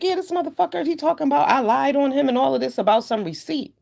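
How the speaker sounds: high, exasperated ranting; retold rant. Retelling her reaction to his claims, high and exasperated. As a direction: go off in disbelief, pitched high. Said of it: "I was like".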